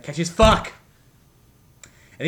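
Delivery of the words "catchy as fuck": louder, higher punchy repeat; emphatic exclamation. Real, not acted. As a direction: shout it with glee. Repeats phrase loudly for emphasis.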